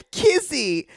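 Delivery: high, slow knowing Kizzy; knowing drawl. Retold or imitated. Imitating a guest saying the name knowingly. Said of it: "he said it in a way that was like".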